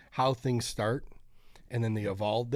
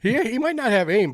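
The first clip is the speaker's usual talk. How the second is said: excited; 10 semitones higher, 9 dB louder than usual. Real, not acted. Praising the thrower's speed.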